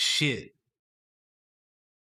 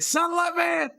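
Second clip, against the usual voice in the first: much higher, loud exclamation; excited shout; 3 dB louder than usual. Real, not acted. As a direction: yell it out in delight. Excited cry at a game event.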